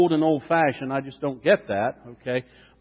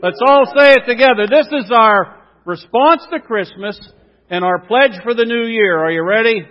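rallying call; 4 semitones higher, 7 dB louder than usual. Real, not acted. Rallying congregation for response.

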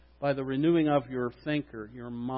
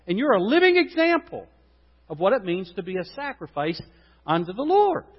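preaching peak; 4 semitones higher than usual. Acted. Preacher lifting the church, high climax. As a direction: lift it up to the rafters.

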